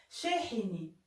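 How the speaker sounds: usual speaking voice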